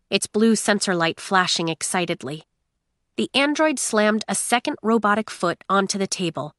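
ordinary delivery

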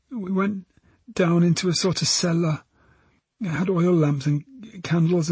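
put-on high girl's voice, remembering; young witness recounting, pitched high; frightened teenage girl. Girl describes the cellar to detectives, subdued.

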